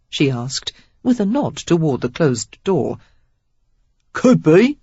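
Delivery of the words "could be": easy, loud Cockney rogue; breezy Cockney charmer; Cockney accent. A narrator's reading. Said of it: "Cockney in his accent".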